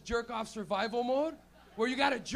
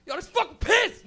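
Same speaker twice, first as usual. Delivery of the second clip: loud, higher, stretched-out outraged yell; hammed-up shocked yell; mock disgusted yell; 10 semitones higher, 15 dB louder, slower than usual. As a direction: shout it in horrified disgust. Flatbed guy discovers piss.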